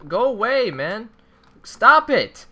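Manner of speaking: high, loud angry shouting; frustrated yelling. Real, not acted. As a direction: yell at the teamers. Teamed up on, yelling.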